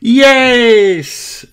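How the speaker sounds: high, loud, drawn-out cheer. A narrator's reading. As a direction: cheer as the delighted little friends. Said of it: "everyone cheers".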